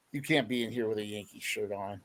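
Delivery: low, soft, sly teasing line; hushed and coy, like a sly joke being sprung. Retold or imitated. Coach teasing a nervous reporter, low sly murmur. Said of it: "in a real coy, uh, sly way".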